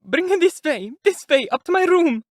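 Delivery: high, loud urgent pleading; begging. A narrator's reading. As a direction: plead with the men to carry her. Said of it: "Sonia begged".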